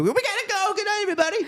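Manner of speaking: high mock host sign-off; Jay Leno voice. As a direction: wrap the show cheerily. Jay signing off.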